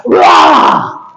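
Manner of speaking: raw, harsh burst of a scream; re-enacted scream. Preacher acting out his breakdown in the trench, screaming. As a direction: let out a sudden, wordless mock scream. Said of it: "ahí comienzo a gritar".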